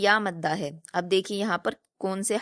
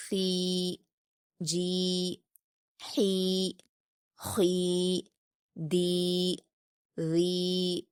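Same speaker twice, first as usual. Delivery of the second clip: Qaida letter recitation; much slower than usual. Shown as a demonstration. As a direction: recite each syllable slowly with a pause.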